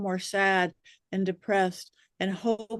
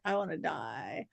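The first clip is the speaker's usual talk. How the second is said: much softer, low, deflated; a hushed, lifeless groan of despair; mock despair; 6 semitones lower, 12 dB quieter than usual. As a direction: sink into hopeless defeat. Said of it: "And I'm just like".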